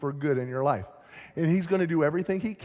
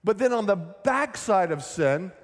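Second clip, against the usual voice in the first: ordinary delivery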